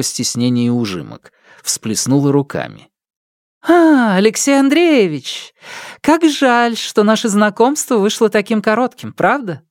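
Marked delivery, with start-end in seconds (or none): 3.7-9.6 put-on high, lively girlish voice; chirpy court lady calling out; bright young lady. (звонкий девичий голос)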